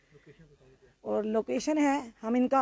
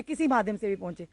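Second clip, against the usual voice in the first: ordinary delivery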